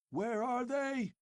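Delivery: shrill high howl, stress on are. Acted. Old chief howling at Conan, brandishing his sword. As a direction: howl the demand. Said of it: "howled the old chief".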